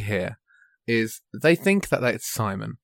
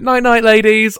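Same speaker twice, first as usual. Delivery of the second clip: mock sing-song goodnight; 9 semitones higher, 7 dB louder than usual.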